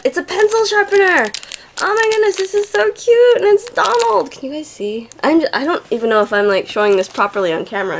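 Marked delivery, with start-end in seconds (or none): 0.0-4.3 high-pitched excited gushing; too excited. Real, not acted. Showing viewers a cute gift, excited. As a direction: gush high over something adorable. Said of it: "I'm just too excited".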